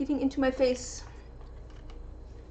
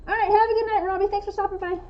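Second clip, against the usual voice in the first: bright greeting; 7 semitones higher, faster than usual. Real, not acted. Greeting stream viewer.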